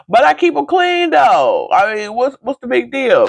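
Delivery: high, playful squeal of protest; mock-defensive protest. Daughter answering mother's towel teasing, high mock indignation. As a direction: plead your case high up in your voice.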